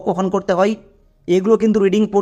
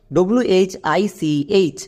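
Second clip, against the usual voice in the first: slow spelling out; much slower than usual. Shown as a demonstration. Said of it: "যেমন".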